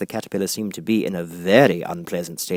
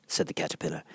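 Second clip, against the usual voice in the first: ordinary delivery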